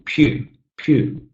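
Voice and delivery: drawn-out PEW, said on its own; stressed syllable. Shown as a demonstration. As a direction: say "pew" long and clear as the stressed beat. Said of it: "The syllable that I'm stressing here".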